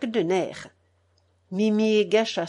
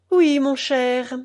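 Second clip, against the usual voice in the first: slow, higher and louder, icily polite; cold reproach; 5 semitones higher, 5 dB louder, slower than usual. A narrator's reading. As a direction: draw it out with icy politeness.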